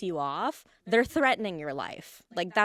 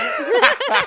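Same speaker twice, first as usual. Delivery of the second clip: loud laugh; 14 dB louder than usual. Real, not acted. Joking about elevators.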